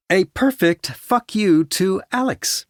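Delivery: very high, slow, excited female character; rising excitement. A narrator's reading. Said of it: "her voice rising excitedly".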